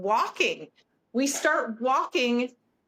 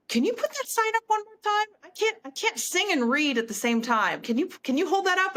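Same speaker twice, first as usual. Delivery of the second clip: raised, lightly voiced star asking into the mic; 9 semitones higher than usual. Singer asking fan over the mic, lightly voiced. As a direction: play the singer asking the fan from stage, higher. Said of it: "and in her microphone says".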